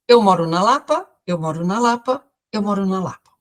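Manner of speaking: imitating the patient's triple repetition; repeating three times. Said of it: "Com essa entonação". (repetindo tudo três vezes)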